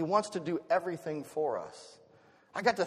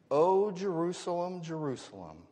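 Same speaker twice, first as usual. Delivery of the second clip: understated lament; 3 semitones higher than usual. Acted. Jesus lamenting over his city, in restrained longing. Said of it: "He whispers the words that we find".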